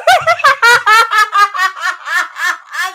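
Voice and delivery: shrieking laugh; loud burst of laughter. Real, not acted. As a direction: cracks up loudly.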